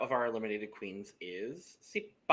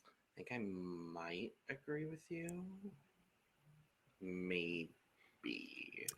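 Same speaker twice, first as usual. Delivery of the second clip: whisper; 4 semitones lower, 16 dB quieter, much slower than usual. Real, not acted. Whispered aside.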